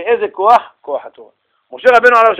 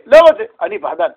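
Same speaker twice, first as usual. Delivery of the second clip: proud coward; 10 semitones higher, 6 dB louder than usual. Retold or imitated. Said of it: "התשובה היא".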